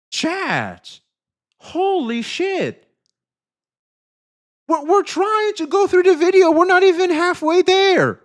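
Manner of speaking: high, loud exasperated cry; high, loud stunned complaint; overwhelmed shout. Real, not acted. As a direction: shout it out, swamped by alerts. Overwhelmed by donations.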